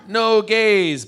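high, loud, drawn-out declaration; emphatic and stretched; blunt exclusion. Acted. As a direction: belt it out as a blanket ban. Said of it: "what they're saying is".

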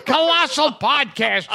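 bright upbeat host intro; podcast host intro. Acted. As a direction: announce the show with a grin.

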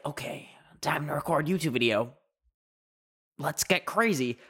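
mock gearing up to film; YouTuber voice. Creator pretending to psych up for a YouTube video, hammed-up YouTuber voice. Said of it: "that is what I sound like in my YouTube videos".

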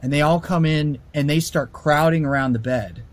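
ordinary delivery

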